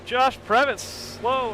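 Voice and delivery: sudden high alarmed call, slowing down; surprised shout. Real, not acted. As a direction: blurt it out as the car snaps sideways. Sudden spin during race.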